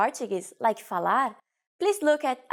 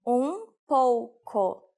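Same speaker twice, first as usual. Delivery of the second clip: "Um pouco" broken into slow, separate syllables; slow teaching repetition; much slower than usual. Shown as a demonstration. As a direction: say "Um pouco" very slowly for learners. Teacher repeats phrase slowly. (drawn-out pronunciation model)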